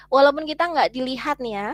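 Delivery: ordinary talk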